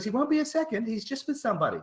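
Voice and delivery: high-pitched mock receptionist voice. Gamer retelling being kept waiting, mock receptionist. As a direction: imitate her, far above your own pitch. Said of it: "And she goes".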